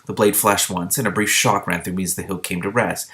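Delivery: ordinary delivery, nothing marked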